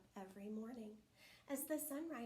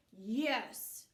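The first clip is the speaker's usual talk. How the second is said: bear growl; 9 dB louder than usual. Acted. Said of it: "Bear growled".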